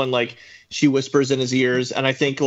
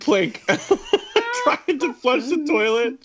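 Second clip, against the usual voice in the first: laughing; 12 semitones higher, much slower than usual. Real, not acted.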